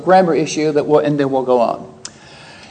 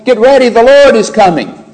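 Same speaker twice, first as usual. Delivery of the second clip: raised, higher and louder proclamation; shouting herald; 6 semitones higher, 5 dB louder than usual. Retold or imitated. Messenger to the people as he comes, shouting. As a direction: call it out like a herald announcing an arrival. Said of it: "he's shouting".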